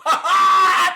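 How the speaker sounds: loud, high taunting cackle; a big gloating laugh; mocking laugh. Real, not acted. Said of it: "I'm laughing at them".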